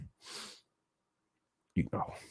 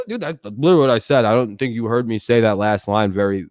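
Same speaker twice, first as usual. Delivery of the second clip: ordinary talk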